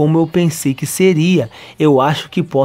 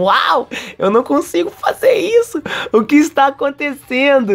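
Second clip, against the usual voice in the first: very high-pitched desperate squeal; mock panicked cry; 9 semitones higher than usual. Kazuya screaming to himself, high-pitched mock panic. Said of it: "dá um grito pra si mesmo".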